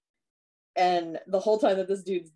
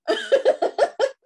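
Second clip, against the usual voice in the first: a burst of high, loud laughter; 8 dB louder than usual. Real, not acted. Laughing at the question.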